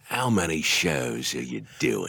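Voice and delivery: low, slow Aussie host voice; Australian DJ. Retold or imitated. [name] mocking the interview, slow low Australian accent. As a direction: ask it slow and deep, Aussie style. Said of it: "mimics the DJ voice".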